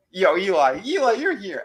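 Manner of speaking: high, thrilled call to a pal; excited greeting. Retold or imitated. Recapper as tripping man greeting his lizard pet, excited. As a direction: imitate happy surprise at finding a friend.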